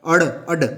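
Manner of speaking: अड़, अड struck sharply like a slap; slap-like flapped sounds. Shown as a demonstration. Teacher demonstrates flapped consonants to students, sharp and slapping. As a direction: snap the tongue on अड़ and अड. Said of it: "जैसे कोई थप्पड़ मार रहा है".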